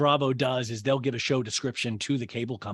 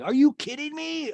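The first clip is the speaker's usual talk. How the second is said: much higher, stunned question; mock disbelief; 13 semitones higher, 3 dB louder than usual. Imagined Shep in disbelief.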